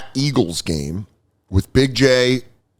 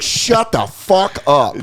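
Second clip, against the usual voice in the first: dismissive retort; 7 semitones higher, much slower than usual. Retold or imitated. Retorting to whiny fans.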